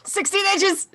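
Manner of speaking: high, loud comic interjection; mock shout. Game master answering a whispered question, high mock shout. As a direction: blurt it out high over the whisper.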